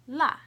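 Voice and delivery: "la" short, falling sharply from high; fourth tone. Shown as a demonstration. Teacher showing the four tones, "la" falling. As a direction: drop "la" fast from the top. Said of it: "I'll say the four tones".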